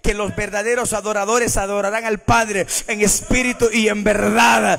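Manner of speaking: loud, emphatic scripture proclamation; forceful preaching. Real, not acted. Preacher proclaiming the Lord's words on true worship, forceful. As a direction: proclaim it with building volume.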